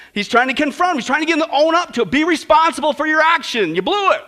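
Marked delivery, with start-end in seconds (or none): none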